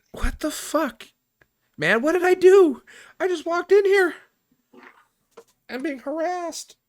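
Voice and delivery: high, loud mock outrage; squealing retold indignation; high outraged rant. Outraged inner reaction retold.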